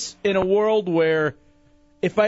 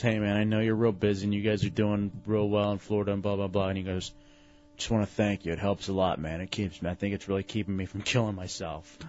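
low, quiet mopey friend; depressed friend voice; 10 semitones lower, 7 dB quieter, faster than usual. Retold or imitated. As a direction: mumble it low like he's worn down. Retold depressed friend's call.

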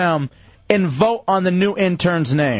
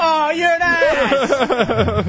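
laughing; 9 semitones higher, much slower than usual. Real, not acted. Caught out by cohost's joke.

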